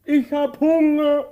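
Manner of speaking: high, loud whine; loud childish whining; whining complaint. Acted.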